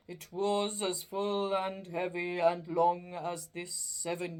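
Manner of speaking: slow, loud, mournful spirit voice; a chained ghost lamenting its fate; doleful ghost. Acted. Marley's ghost lamenting to Scrooge, slow and doleful.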